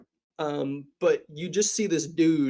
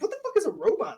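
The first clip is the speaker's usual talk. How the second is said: high, baffled outburst; confused drinker asking what a robot is; baffled patron; much faster than usual. Acted. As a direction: squeak out the question in disbelief. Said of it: "It's like".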